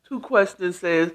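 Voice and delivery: ordinary talk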